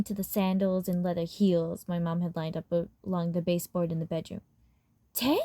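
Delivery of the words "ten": a short, dismissive scoff. Acted. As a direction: toss the word off in disbelief. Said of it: "my mom scoffed".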